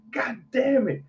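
high-pitched mock exasperation; playful imitation of an annoyed dad; retold exasperation. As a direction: curse like a fed-up dad, imitated. Dad comes home to mess.

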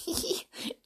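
small, soft acted giggle. A narrator's reading. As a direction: giggle lightly to yourself. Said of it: "Beth giggled".

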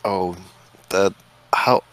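ordinary talk, nothing marked

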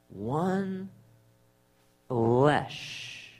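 hushed, drawn-out words; solemn scripture reading. Real, not acted. Preacher repeats the verse after "what does that mean", solemn and hushed. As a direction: let each word hang softly.

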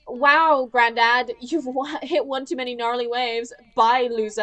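mocking Malia snubbing granddad; dismissive teen. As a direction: blow him off like a bratty teen. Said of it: "she's like".

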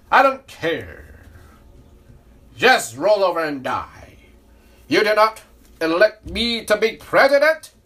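a low, loud, slowed mock of the president; Donald Trump. Mocking Trump's defiance to listeners, low and loud. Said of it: "He said".

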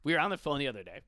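normal talking voice

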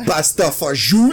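over-the-top fake Italian accent; bad Italian accent. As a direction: belt it out in mock Italian. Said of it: "loudly doing a shitty Italian accent".

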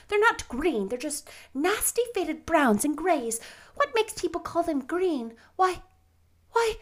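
put-on high, eager girlish voice; excitable chatty girl. Anne chattering on Christmas morning.

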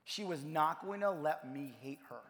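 ordinary talk

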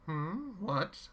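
high, curious villain. A narrator's reading. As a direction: ask it mockingly. Chaos voiced.

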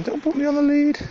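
high squeaky mock dog voice; voicing the dog. Owner voicing her dog protesting the lead, high mock whine. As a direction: play the dog whining back at its owner. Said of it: "She's going".

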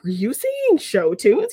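retold accusing question, rising at the end; incredulous questioning. Retelling Cooper catching the rock star singing, mock incredulous.

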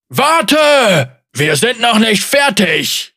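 high, loud roar after a fleeing foe; roaring giant. A narrator's reading. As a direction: bellow it out as the colossus. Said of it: "brüllte der Koloss".

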